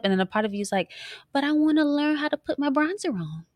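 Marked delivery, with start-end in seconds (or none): 1.3-3.4 high-pitched mock whine; pleading. Wife voices the part wanting makeup tips, mock pleading. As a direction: voice a wistful inner protest, pitched up. Voicing her conflicted other side.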